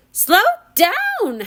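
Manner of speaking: ordering. Acted. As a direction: bark the order at the coachman. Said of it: "Hattie ordered".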